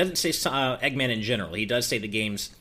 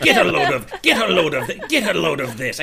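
louder laughing Doctor Eggman imitation; Eggman impression; 5 semitones higher, 6 dB louder than usual. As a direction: ham it up as a gloating villain. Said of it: "you can see on Sonic Adventure, he's".

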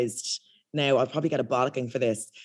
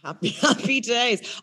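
put-on slow, higher copy of a London accent; imitated London accent; 6 semitones higher, slower than usual. Echoing the man's catchphrase, imitated London accent. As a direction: echo it slowly in a London accent. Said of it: "I do the accent".

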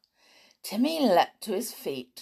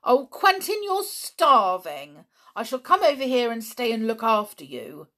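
higher, louder worried cry; distressed; 5 semitones higher, 6 dB louder than usual. Acted. Wife fretting that her husband is starving, distressed. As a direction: fuss over him in alarm. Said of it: "watched him in distress".

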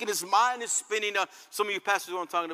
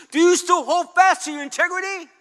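higher, louder bitter outcry of a grieving wife; despairing wife; 7 semitones higher, 7 dB louder than usual. Retold or imitated. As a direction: throw it back at him, raw and fed up. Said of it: "she's crying and weeping".

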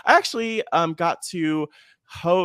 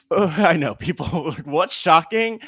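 hammed-up disbelief, higher and louder; mock shock; 4 semitones higher, 5 dB louder, slower than usual. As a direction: laughingly play the scandalized listener. Mock shock at advance recording.

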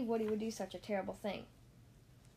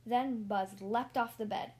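ordinary delivery

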